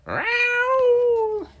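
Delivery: very high, loud startled yowl; piercing cat shriek; scared cat meow. Shown as a demonstration. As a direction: screech it like a frightened cat. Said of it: "make it more scared".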